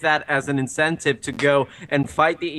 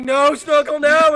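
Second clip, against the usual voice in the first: much higher, louder cry; anguished shout; 12 semitones higher, 7 dB louder than usual. Acted. Begging Snorkel to stop, loud and high. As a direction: plead and yell at once. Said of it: "And I was like".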